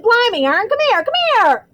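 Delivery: high, loud urgent calling; excited shout. A narrator's reading. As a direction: yell for your mate to come over. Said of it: "Stan shouted".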